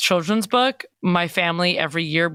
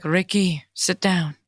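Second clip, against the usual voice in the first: lightly put-on Swedish accent; mock Swedish accent. Host voicing the painter calling Ricky, shaky Swedish accent attempt. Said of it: "don't know what this accent is".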